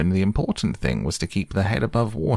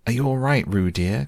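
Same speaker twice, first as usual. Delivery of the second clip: anxious call; 6 semitones higher than usual. Acted. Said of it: "called Kanga anxiously".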